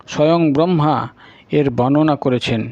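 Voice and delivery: ordinary delivery, nothing marked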